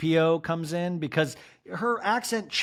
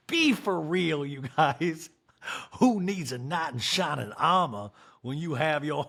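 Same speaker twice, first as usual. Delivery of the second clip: ordinary talk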